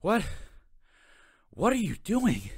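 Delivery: breathless, slow question; out of breath. Acted. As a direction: gasp the words out of breath. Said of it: "Anthony was all out of breath".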